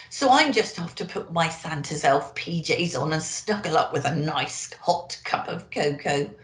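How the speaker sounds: ordinary delivery, nothing marked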